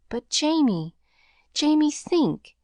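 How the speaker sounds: a mother pleading with her son to go; quavering plea. A narrator's reading. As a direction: beg him to reconsider. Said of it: "quavered Missus Murphy".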